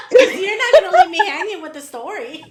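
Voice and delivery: loud, high, laughing protest; amused, high-pitched outburst. Real, not acted. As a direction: protest loudly through laughter. Laughing reply to the tease.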